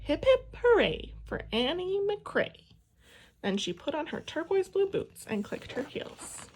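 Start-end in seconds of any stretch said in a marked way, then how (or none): 0.0-2.8 high, slow toy-horse cheer; cheering for herself. A narrator's reading. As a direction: cheer through her stuffed horse. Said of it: "moved his mouth ... while she cheered".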